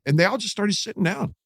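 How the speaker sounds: usual speaking voice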